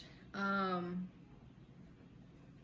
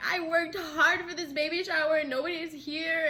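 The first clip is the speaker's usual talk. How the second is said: high, loud mock lament; sad pregnant girl; 7 semitones higher, 7 dB louder than usual. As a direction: wail a hammed-up complaint. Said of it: "like a sad pregnant girl".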